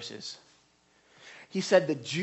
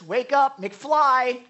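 higher, louder, mocking rebuke; mocking duh; 5 semitones higher, 5 dB louder, slower than usual. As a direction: talk down to them like slow students.

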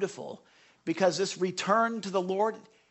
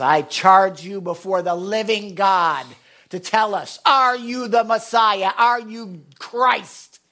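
slow, loud demanding charge; 7 dB louder, slower than usual. Retold or imitated. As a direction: press him hard, slow and loud. High priest charging Jesus.

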